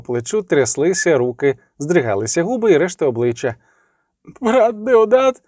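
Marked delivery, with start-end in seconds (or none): 4.4-5.4 high, drawn-out tearful wail; a weeping young monk, whimpering slowly; sobbing. A narrator's reading. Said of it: "схлипнув він".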